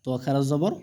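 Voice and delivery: ordinary delivery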